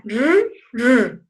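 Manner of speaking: "r" as a short rolled trill, by itself; strong trilled R. Shown as a demonstration. Teacher sounding out the strong R, isolated trill. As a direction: trill "r" twice, separately. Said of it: "va a sonar".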